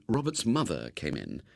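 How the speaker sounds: usual speaking voice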